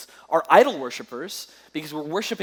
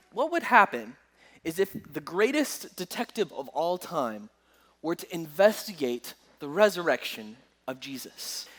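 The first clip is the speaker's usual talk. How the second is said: ordinary talk, nothing marked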